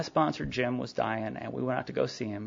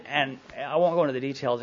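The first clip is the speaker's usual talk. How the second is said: ordinary talk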